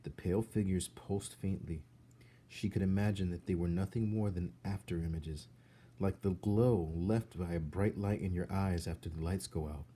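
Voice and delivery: ordinary delivery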